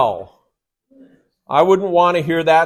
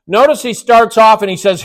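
usual speaking voice

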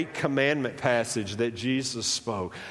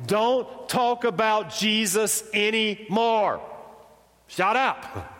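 ordering silence; 8 semitones higher, slower than usual. Retold or imitated. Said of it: "they were ordered".

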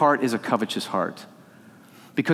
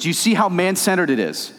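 louder, higher preaching, driving every line home; preaching at full force; 8 semitones higher, 5 dB louder than usual. Acted. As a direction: pastor pleading with the congregation to leave greed, full preaching voice.

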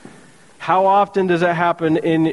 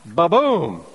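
ba-boom. Retold or imitated. Lightning strike sound.

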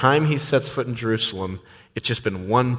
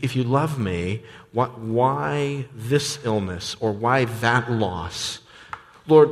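higher, searching lament to God; pleading prayer; 3 semitones higher than usual. Acted. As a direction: plead with God like a troubled believer. Voices a believer's anguished prayer.

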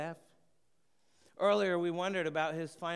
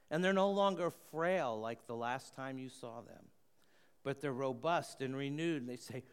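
ordinary talk, nothing marked

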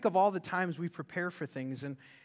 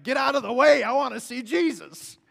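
mock pushy pastor; 6 semitones higher, 9 dB louder than usual. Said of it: "he's like".

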